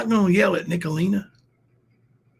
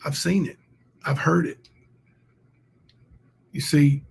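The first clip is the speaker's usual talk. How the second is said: ordinary talk, nothing marked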